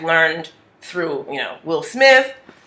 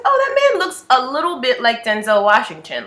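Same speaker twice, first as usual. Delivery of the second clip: curious; 7 semitones higher, 3 dB louder than usual. Retold or imitated. Retold own musing about Denzel.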